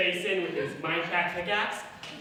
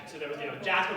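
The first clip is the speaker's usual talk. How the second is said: ordinary talk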